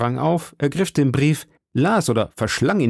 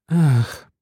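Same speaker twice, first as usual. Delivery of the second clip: higher, sighing ACH; sighed; 5 semitones higher, 4 dB louder than usual. A narrator's reading. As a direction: let it out as a sigh. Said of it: "seufzte Aramis".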